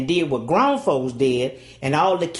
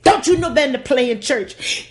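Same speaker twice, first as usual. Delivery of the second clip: higher, louder scolding, stress on you; a stern father's rebuke; daddy scolding; 7 semitones higher, 4 dB louder than usual. Retold or imitated.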